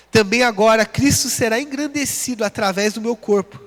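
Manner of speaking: normal talking voice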